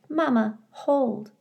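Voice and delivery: high small voice, lightly done; a baby reaching for his mama; little gosling. Acted. Gosling asking his mother to hold him, high.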